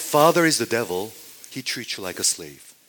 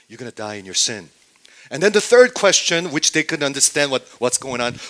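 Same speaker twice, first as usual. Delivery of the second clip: ordinary delivery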